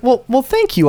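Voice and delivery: sugary fake politeness; thanking the agent in mock sweetness; mock gratitude. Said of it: "I was like".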